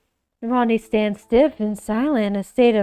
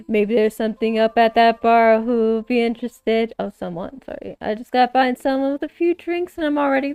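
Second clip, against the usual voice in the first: usual speaking voice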